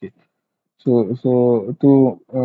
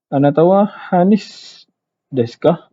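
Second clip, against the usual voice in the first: Anatawa Hanis desu ka? lifted higher, a friendly question; asking a name; 3 semitones higher than usual. Acted. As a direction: act out asking someone her name. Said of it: "dia tanya nama kan". (lakonkan bertanya nama seseorang dengan nada lebih tinggi)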